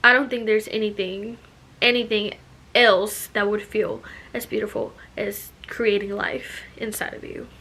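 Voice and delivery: usual speaking voice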